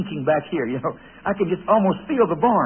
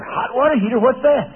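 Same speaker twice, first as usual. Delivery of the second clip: put-on naive boy; 7 semitones higher than usual.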